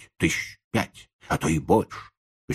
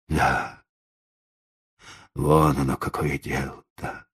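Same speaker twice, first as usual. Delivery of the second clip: lower, sunken, resigned; dejected voice; 4 semitones lower than usual. Acted. Old man realising the dog is stolen, dejected. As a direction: let the voice drop in defeat. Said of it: "произнёс старик упавшим голосом".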